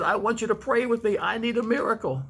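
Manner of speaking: high, pleading retold plea; a touch of a distraught woman asking for prayer; falling apart. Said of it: "She was falling apart".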